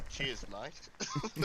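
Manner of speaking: broad Aussie "cheers mate"; Australian accent. Acted. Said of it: "The accent came in very late there".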